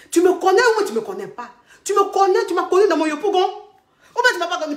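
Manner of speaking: challenging her cousin, loud and mocking; challenging retort. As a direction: throw the question back at her.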